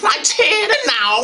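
loud, mock Scouse burst; put-on Liverpool Scouse; Scouse accent. Shown as a demonstration. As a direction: slip briefly into broad Scouse. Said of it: "go into my Scouse accent".

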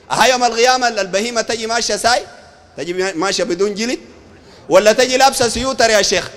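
ordinary delivery

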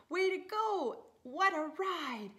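high, loud cheer; cheering parents. Acted. Lucy's parents cheering loudly at the finish. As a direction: cheer her over the line.

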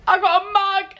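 high, thrilled announcement; pitched right up with glee; delighted squeal. Real, not acted. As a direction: show off the present in an excited high voice. Showing off a gift with delight.

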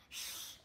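soft breathy hiss; goose hiss. Shown as a demonstration.

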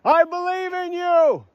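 long, high full-voiced movie yell. Film boy calling out to his friend, yelling. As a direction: holler it out, drawing out the last sound. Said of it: "he would yell".

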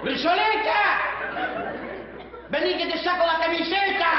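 high, projected call. Acted. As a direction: call over a stubborn cow. Llama a la vaca. (llamando a gritos a la vaca)

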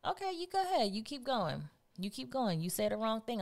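high, lilting dare; warning. Acted. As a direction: egg them on with a veiled threat. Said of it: "I used to tell him".

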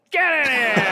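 high, loud cheer of a doorman; big drawn-out welcoming shout; rowdy welcome. Acted. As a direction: holler them in with gusto. Doorman welcomes correct password.

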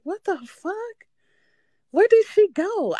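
high, spooked disbelief; creeped out. Retold or imitated. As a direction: squeal it like you just saw a ghost. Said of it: "I'm creeped the fuck out".

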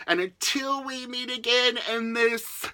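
normal talking voice

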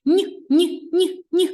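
high, loud teasing no no no; playful no-no. Pretend. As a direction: wag a finger at the wrong answer. Playful rejection. (грайливе «ні, ні, ні»)

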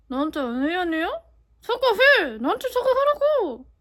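building from scolding to a furious shout; angry shout. Shown as a demonstration. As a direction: yell the demand for an apology. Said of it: "이렇게. 되게 잘하죠".

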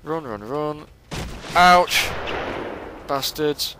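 frantic yelps while fleeing gunfire; panicked, running under fire; being chased. Real, not acted. I'm just gonna run.

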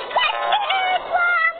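eager high cry; excited high yelp. Real, not acted. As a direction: get the plum squeaked high. Urging in game.

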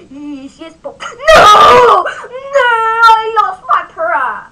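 high crying shout, slow and wailing; Pony's voice. Acted. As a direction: wail it out like a heartbroken pony. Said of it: "I am good at acting voices".

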